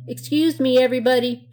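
high, loud call over a crowd; crying out. A narrator's reading. Blanche needs to leave the party, crying out. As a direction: cry out to get everyone's attention. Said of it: "she cried out".

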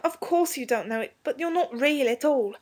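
put-on high-pitched boy pleading his case; desperate. A narrator's reading. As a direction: explain desperately as a young boy. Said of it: "said Robert desperately".

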